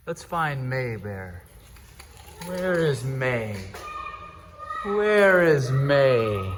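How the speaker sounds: slow, soft, teasing seeker; playful seeker. Pretend. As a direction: creep after her playfully. Playful hide and seek.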